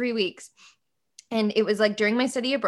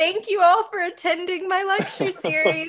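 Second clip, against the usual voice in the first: higher, prim mock lecturer; mock professor; 9 semitones higher, 4 dB louder than usual. Lecturer closing the session, higher mock voice. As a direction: wrap up the lecture in a lightly put-on teacher voice.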